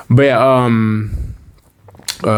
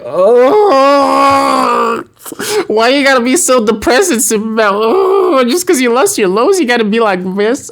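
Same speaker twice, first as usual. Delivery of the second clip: fake-cry a complaint at Superman, much higher; mock wailing; 13 semitones higher than usual. Fan whining at sad Superman, high hammed-up wail. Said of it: "And I'm like".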